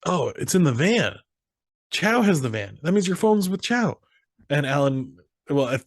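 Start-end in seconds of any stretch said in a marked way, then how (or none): 0.1-4.0 mildly raised, excited retelling of Phil; a light impression of Phil working it out; retold Phil. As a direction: puzzle it out a bit higher and quicker, as Phil. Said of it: "He goes".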